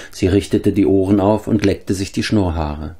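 ordinary delivery

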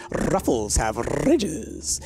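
slow ad voice with rolled R's; Ruffles commercial voice. Retold or imitated. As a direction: roll the R's like a chip ad. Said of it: "you listened to a Ruffles commercial".

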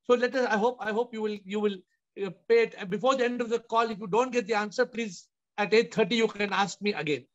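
usual speaking voice

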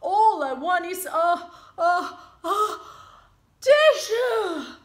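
high, loud stuttering before a sneeze; building to a sneeze. Acted. Wolf stuttering into his biggest sneeze. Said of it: "He sneezed".

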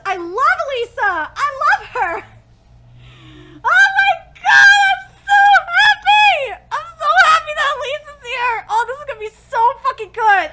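ecstatic screaming. Real, not acted. Vlogger thrilled her favourite gym leader appears, ecstatic screaming. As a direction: scream with pure fan joy.

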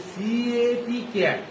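সিএটি letter by letter, slowly, then ক্যাট whole; spelling out cat. Shown as a demonstration. Teacher models spelling for pupils, slow spelled-out cat. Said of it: "কারণ আমি বললাম".

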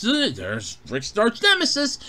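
ordinary delivery

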